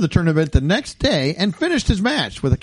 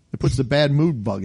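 normal talking voice

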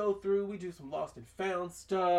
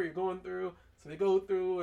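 normal talking voice

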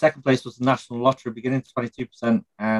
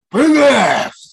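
high, loud shouted impression; Pacino yell; 12 semitones higher, 8 dB louder than usual.

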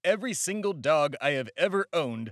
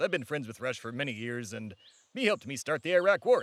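ordinary talk, nothing marked

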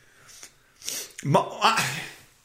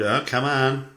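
normal talking voice

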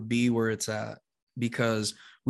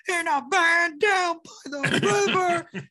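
high, slow, strained comic bellow; hammed-up catchphrase, dragged out high; YouTube clip imitation; 4 dB louder, much slower than usual. Comic imitating the clip his wife played, hammed-up high bellow. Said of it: "my wife pulls the YouTube clip of".

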